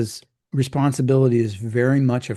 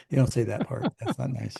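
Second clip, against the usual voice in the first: ordinary talk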